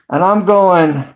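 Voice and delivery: ordinary talk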